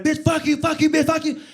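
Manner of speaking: high, loud rapid-fire insults; mimicked shrieking quarrel; retold cursing match. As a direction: trade curses back and forth. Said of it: "her and this woman are just going back".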